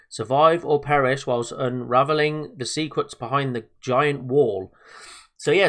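normal talking voice

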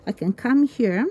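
normal talking voice

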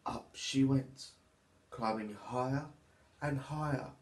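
put-on lively, sing-song reading for little children; storybook narration. A narrator's reading. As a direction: read it like storytime for toddlers.

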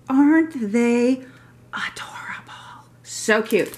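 eerie hushed whisper; creepy whisper. Acted. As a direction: whisper it like something spooky in the dark. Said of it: "I said it in a creepy voice".

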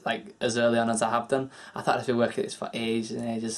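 usual speaking voice

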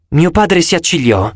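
ordinary delivery, nothing marked

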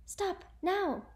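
high, urgent command; sudden gasp. A narrator's reading. Kesha suddenly stopping the bus, urgent gasp. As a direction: halt them with high-pitched alarm. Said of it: "gasped suddenly".

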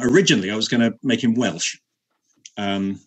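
normal talking voice

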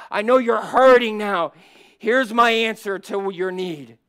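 loud, high, building preacher's voice; climbing sermon crescendo; preaching at full force. Acted. Preaching builds through God's reply.

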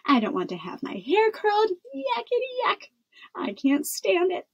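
high, whiny protest; calling out. A narrator's reading. Princess complaining about hair curling, calling out. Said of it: "Isabella called out".

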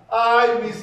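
high, loud mock wail like la Llorona. Mocking the crying dad in the stands, loud high Llorona wail. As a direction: wail it like a weeping ghost, hammed up. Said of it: "como la Llorona".

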